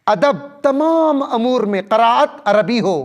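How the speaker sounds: normal talking voice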